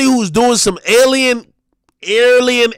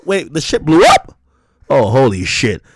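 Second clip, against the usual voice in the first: ordinary talk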